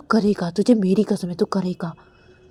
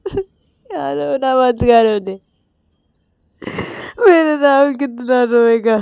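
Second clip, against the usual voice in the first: sobbing; 3 semitones higher, 4 dB louder than usual. Retold or imitated. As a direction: wail it, barely holding the words. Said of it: "Ravi रोते रोते बोलते". (फूट-फूट कर रोते हुए)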